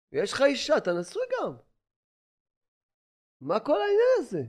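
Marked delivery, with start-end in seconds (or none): 3.4-4.4 indignant outcry. Acted. Rebuking listener.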